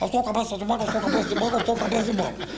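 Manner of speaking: hurried put-on voice repetition; imitated rushed, comic recitation; quick repeats. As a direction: gabble it back obediently. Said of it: "Agora três vezes rapidinho".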